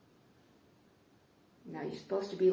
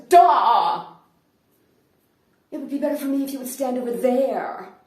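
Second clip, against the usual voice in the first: loud, higher bark on the first word, then an insistent demand; agitated outburst; 4 semitones higher, 15 dB louder than usual. Retold or imitated. Agitated German woman bursts out at him on the street corner, shouting. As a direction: burst out at a stranger who stands in your way. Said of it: "she bursts forth and she says".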